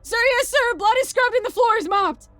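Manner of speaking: high, full-volume boot-camp exchange; drill shouting. Acted. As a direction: shout like sergeant and recruit at drill. Sergeant drills private on cleaning.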